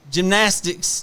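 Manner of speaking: high, proud gymnast's announcement; triumphant child. Retold or imitated. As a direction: show off triumphantly after a clumsy tumble. Child's triumph after a tumble.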